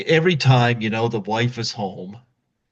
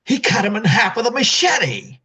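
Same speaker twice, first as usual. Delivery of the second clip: angry father; 9 semitones higher, 4 dB louder than usual. Retold or imitated.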